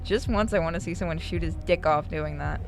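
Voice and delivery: usual speaking voice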